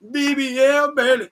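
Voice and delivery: high, loud, half-laughing callout; laughing hype. Pretend. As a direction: yell it like a ring announcer. Repeats hype through laughter.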